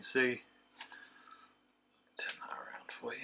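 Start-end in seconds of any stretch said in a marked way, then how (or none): 2.2-3.2 whisper. Real, not acted. Turning the can for the camera.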